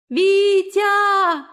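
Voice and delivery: high, loud tearful plea; terrified cry. A narrator's reading. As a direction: cry out in terror, near tears. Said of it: "звенящий испуганными слезами голос Дины".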